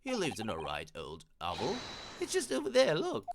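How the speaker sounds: police inspector character. Acted. Inspector directing visitors to the lodgings, in character voice. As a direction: point out the lodgings as a chatty inspector.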